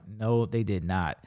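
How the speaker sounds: low, soft, slow denial; firm contradiction. Retold or imitated. Fan rejecting her claim about his band, firm. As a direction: drag it out low, refusing to budge.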